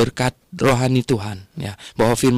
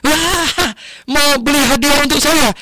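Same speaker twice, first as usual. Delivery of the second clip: high, louder delighted surprise; surprised grandpa; 5 dB louder than usual. Retold or imitated. Preacher playing grandpa touched by the gift. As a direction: squeal with delight that the gift is for him. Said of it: "Kakeknya dengar itu kaget".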